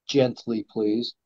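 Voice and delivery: soft and low, easing it out; hushed, coaxing please; gentle. Shown as a demonstration. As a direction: say it softly, like a gentle nudge. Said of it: "When you have it, you just sort of".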